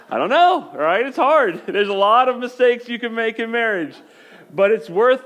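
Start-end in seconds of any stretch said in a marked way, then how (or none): 0.1-3.4 higher and louder, laughing through the words. Real, not acted. Pastor to congregation, laughing at his own mistake. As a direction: still cracking up as you admit it.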